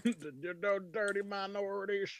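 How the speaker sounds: hammed-up film-quote impression; Charlton Heston. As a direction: draw it out high like a hammy film hero. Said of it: "Charlton Heston".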